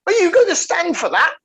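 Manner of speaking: high-pitched telling-off, imitating an irate mother; put-on high voice, mock maternal outrage; scolding mum. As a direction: mimic a mum giving her son an earful. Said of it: "He got shit from his mum".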